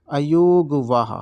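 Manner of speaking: अयोगवाह drawn out slowly, syllable by syllable; teacher stretching अयोगवाह so it sinks in; slow and clear. Shown as a demonstration. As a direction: spell out अयोगवाह slowly for students. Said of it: "इन दोनों को बोला जाता है".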